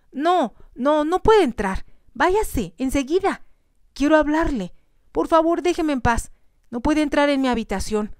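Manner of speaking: frightened cry. A narrator's reading. Young lady crying out as the man forces in, terrified. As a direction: cry out in terror to keep him out. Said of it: "ella lanzó un grito".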